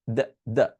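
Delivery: "the" with tongue between the teeth, voiced th; th sound. Shown as a demonstration. Teacher drilling students on the voiced th. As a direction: bite the tongue lightly and say "the". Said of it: "দিয়ে কামড় দিয়ে বলতে হবে the".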